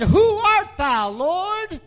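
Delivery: awed cry. Retold or imitated. Paul on the road to Damascus crying out, awed. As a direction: call out in awe to the Lord. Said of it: "He said".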